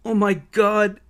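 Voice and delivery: anguished, restrained wail; screaming. A narrator's reading. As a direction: cry out in shock, short of screaming. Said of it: "Sophina and Ruby started screaming".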